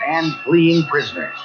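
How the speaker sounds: usual speaking voice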